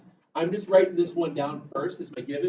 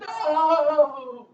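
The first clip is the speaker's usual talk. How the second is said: high, loud group shout; gang yells no; 11 semitones higher, 11 dB louder than usual. Retold or imitated. The bikers shouting down Pee-wee, loud high yell. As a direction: shout him down as the whole gang. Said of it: "And they all yell".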